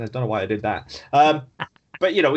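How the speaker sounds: ordinary delivery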